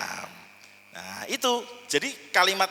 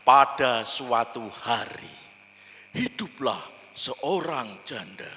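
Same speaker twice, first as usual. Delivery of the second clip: storyteller narration; 4 semitones lower, slower than usual. Shown as a demonstration. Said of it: "Yang paling klasik".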